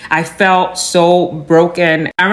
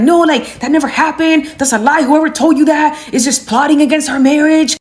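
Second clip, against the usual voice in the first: imitated husband denying everything, high voice; high-pitched, flustered retold protest; spiraling denial; 8 semitones higher than usual. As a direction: pile up frantic mock denials up high. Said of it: "He was spiraling, saying random stuff".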